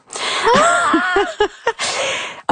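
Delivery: high, held wail breaking up; imitated singing outburst. Recounting tears at choir practice, imitated high singing wail. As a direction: let out a high sustained cry, mimicking singing. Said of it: "J'ai commencé à chanter".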